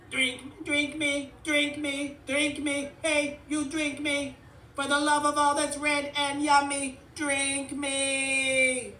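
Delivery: high monotone robot chant, loud; loud, relentless robot pleading; frantic juice box robot. A narrator's reading. Robot demands to be drunk.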